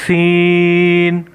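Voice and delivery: سین held very long with a whistling hiss. Shown as a demonstration. Teacher showing pupils the whistling letter, slow. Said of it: "سین میں ہم سیٹی کی آواز".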